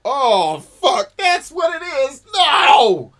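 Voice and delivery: high, loud panicked cry; mock horror. Retelling watching Event Horizon, mock panicked cry. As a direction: recoil in hammed-up terror. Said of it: "And it was like".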